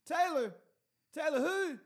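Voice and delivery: loud, high "who" holler; yelling through door. Retold or imitated. Paranoid host questioning the visitor, high loud yell. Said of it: "yelling through the door".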